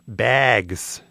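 "bags" with a put-on Midwestern vowel; Midwestern accent. Shown as a demonstration. Cornhole player naming the game, Midwestern accent. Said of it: "Is that a good Midwestern accent?".

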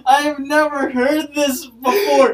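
high, loud, fast disbelief; shrieking disgust. Real, not acted. Reacting to her friend's toilet paper hack, shrieking in disgust.

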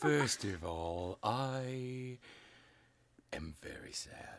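hammed-up sorrowful sigh; whispered fake melancholy; mock sad. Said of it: "I'm Edgar Allan Poe".

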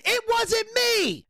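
high, drawn-out shouted denial; re-enacted indignant outburst, slow and high; retold angry screaming. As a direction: protest your innocence at a mock yell. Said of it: "I am fucking screaming at Alan Funk".

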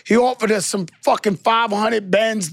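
ordinary talk

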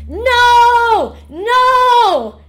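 put-on high, loud child's scream of no. Teacher imitating a child screaming no in class. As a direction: shriek it at the top of the lungs. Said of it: "she's screaming at the top of her lungs".

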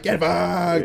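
put-on high, loud drunk shout; drunk yell. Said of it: "and he's like".

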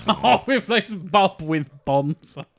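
slow words broken by laughter; laughing. Real, not acted. Cracking up at the Bonza joke while speaking. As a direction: laugh through it.

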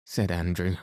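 usual speaking voice

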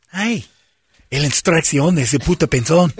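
high, hammy fake Spanish teacher; mock Spanish accent.

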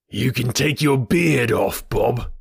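slow, jeering ruffian voice; gloating bully. A narrator's reading. Bully greeting his disguised rival, slow gloating voice. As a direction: drawl it mockingly.

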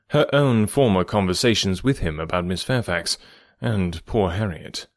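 ordinary delivery

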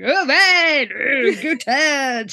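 hammed-up dude bro cheer, dragged out; imitating overexcited jock extras, slowly; mock jock whoop. Retelling the jocks cheering on Todd, hammed-up bro whoop. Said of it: "As the jocks get very extra".

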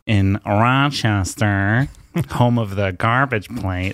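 drawn-out, exaggerated Rochester accent; mock hometown Rochester drawl. Shown as a demonstration. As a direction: stretch it slowly into a Rochester accent. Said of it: "That's how they talk".

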